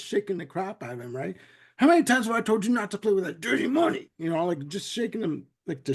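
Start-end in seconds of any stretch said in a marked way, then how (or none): 1.8-4.1 fast, higher, put-on scolding mom; scolding mother. Bugs scolds Finster as a naughty baby, fast and higher. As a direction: rattle off the telling-off like a fussy mother.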